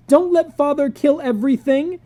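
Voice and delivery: high, loud desperate wail. Acted. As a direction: plead through tears. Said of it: "wailed Peter".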